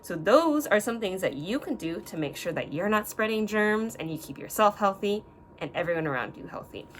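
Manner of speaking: normal talking voice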